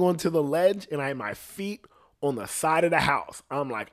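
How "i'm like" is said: ordinary delivery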